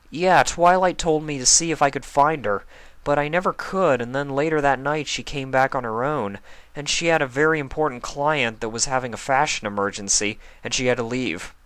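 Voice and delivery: a hint of boyish Spike. Spike explains Rarity left the party, lightly boyish voice. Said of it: "Spike said".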